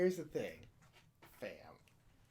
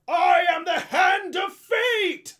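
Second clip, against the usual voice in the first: much higher, loud, slow heroic shout; battle cry; 11 semitones higher, 13 dB louder, much slower than usual. Pretend. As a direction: roar it as you strike the demon. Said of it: "I'm like".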